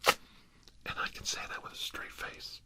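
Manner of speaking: normal talking voice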